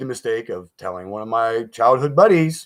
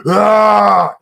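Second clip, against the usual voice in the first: short sharp yell, higher and louder; imitated yell; 7 semitones higher, 7 dB louder than usual. Imitating the ghost screaming in his ear, sharp yell. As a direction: let out a sudden scream like the thing in his ear. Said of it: "like, yelling".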